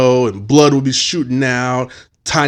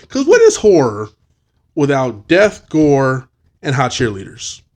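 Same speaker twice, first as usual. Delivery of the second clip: ordinary delivery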